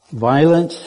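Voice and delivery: ordinary talk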